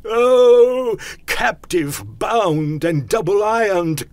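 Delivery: loud, high ghostly wail; anguished cry. A narrator's reading. Phantom lamenting its bondage, crying out. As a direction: wail out each word like a phantom in chains. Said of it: "cried the phantom".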